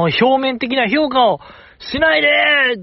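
high, drawn-out cry of appeal; mock plea. Host defends shy members to critics, mock pleading cry.

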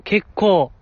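ordinary delivery

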